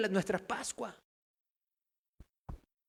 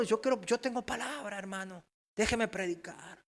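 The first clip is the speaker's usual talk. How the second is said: imitated eager would-be preacher; 2 semitones higher, 7 dB quieter than usual. Infiltrator asks the pastor to let him preach, mock pleading. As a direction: plead lightly for a turn to preach.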